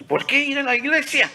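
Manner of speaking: high, loud, rapid preacher's call; loud rhetorical question. Real, not acted. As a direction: fire the question out high and fast. Preacher calls out sermon's question.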